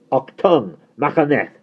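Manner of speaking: high, loud film-German shout; mock-German yell. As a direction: bark "Machaneth" hard, like "Achtung".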